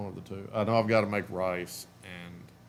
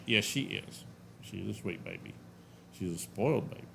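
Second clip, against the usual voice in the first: soft cooing to the dog; 8 dB quieter than usual. Real, not acted.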